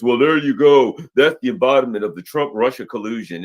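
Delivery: mock pundit. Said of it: "some of these idiots".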